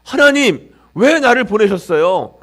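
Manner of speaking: 하나님을 높고 크게 부르며 따지듯; 왜를 세게 던지며 억울하게 호소; 원망하는 부르짖음. Acted. 모세의 원망을 재현. (모세가 하나님께 높고 크게 원망하는 외침)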